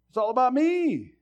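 high, loud cry with a held last word; loud mock egotist's wail; self-centred cry. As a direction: shout it like it's all yours. Mocking self-centred attitude, shouted.

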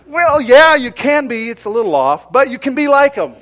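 high, shouted concession; loud encouragement. Retold or imitated. Uncle answering nephew who picks Michael Jordan, loud encouragement. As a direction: concede at full volume with big emphasis.

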